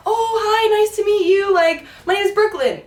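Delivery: lightly voiced chirpy kid; bright chirpy girl greeting. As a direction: mimic a cheerful kid introducing herself. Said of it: "she's like".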